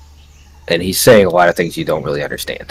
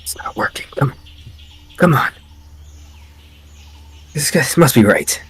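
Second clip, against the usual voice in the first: frustrated muttering; 8 semitones higher than usual. Acted. Tech muttering at an uncooperative machine spirit, frustrated. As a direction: start under the breath, rise into exasperated pleading. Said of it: "You hear Arrow muttering".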